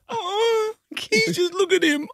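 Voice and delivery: high squeaky mock sob, soft; imitating a teary wife, voice cracking high; mock tearful squeal. Husband imitating pregnant wife pitying the robot vacuum, high mock sob. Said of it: "she's going".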